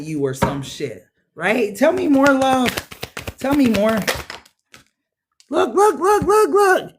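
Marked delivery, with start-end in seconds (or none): 1.8-4.0 excited catchphrase. Real, not acted. Tarot reader's catchphrase to the cards. 5.5-6.9 rapid, much higher and louder calls of look; excited look look. Real, not acted. Tarot reader to viewers as a card flies out, excited high calls.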